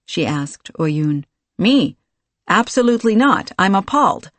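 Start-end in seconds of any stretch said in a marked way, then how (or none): 1.6-4.3 loud, high fake outrage; mock appalled. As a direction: protest innocence, hammed-up.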